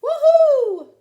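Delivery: high, loud joyful woohoo; whooping. A narrator's reading. Duck whoops with joy driving. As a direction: cheer at full voice.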